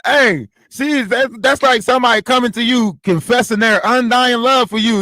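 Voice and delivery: usual speaking voice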